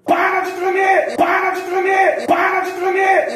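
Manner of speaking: high, loud, drawn-out mock order, repeated identically; hammed-up exasperated shout; acted shouted command. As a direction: yell at your own body to obey. Acted order to trembling body, repeated.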